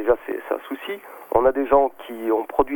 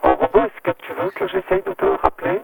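glitchy robotic phone line; distorted digital phone voice. Real, not acted. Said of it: "tu as une voix de robot".

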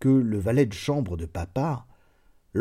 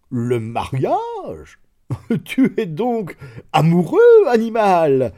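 slow, then bursting into a loud, high laughing taunt; laughing incredulously; 8 semitones higher, 8 dB louder, slower than usual. A narrator's reading. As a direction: baron mocking his lovesick servant through laughter. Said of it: "se mit à rire d'une façon si immodérée".

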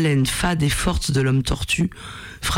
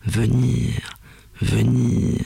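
low, laboured creature voice; a lightly voiced labouring monster; monster speaking with effort; 4 semitones lower, much slower than usual. A narrator's reading. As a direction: force each word out, low. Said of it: "le monstre articula péniblement".

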